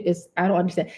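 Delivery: normal talking voice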